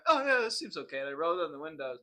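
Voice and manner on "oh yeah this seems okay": squeaky innocent voice, high and bright with hope; high naive self. Retold or imitated. Scared new driver answering the angry man, high and innocent. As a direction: act clueless and eager in a high squeak. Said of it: "So I'm like".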